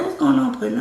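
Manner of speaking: lightly voiced worried mother; worried mom. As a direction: ask gently, sensing something's wrong. Mother greets her at house.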